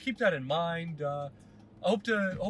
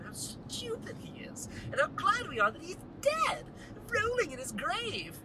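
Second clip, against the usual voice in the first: mocking high voice; 13 semitones higher than usual. Mocking Shakespeare aside.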